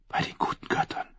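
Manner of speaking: breathless stunned whisper. Acted. Sansa hears her name revealed, whispering in shock. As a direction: barely breathe the words.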